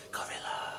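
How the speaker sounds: unvoiced whisper from the bushes; creepy hushed whisper; eerie whisper. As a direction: breathe it out like a hidden voice in the dark. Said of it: "I could hear a slight whispering".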